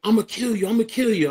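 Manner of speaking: high-pitched imitation of a brother's threat; imitated threat. Younger brother threatens to kill him, imitated high voice.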